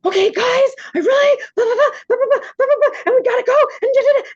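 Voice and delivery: very high, loud hammed-up monster babble; playful monster voice. Mother babbling as a goofy monster to defuse the kids, high and loud. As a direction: ham it up as a silly monster, giggly. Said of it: "you pretend to be a monster".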